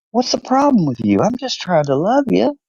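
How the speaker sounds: high-pitched, pleading dog voice; hurt, puzzled dog. Acted. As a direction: play a baffled dog whose affection got rebuffed. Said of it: "he's got his head turned like".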